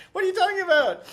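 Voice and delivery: high, loud mock-incredulous cry; retold outburst shot up high and loud.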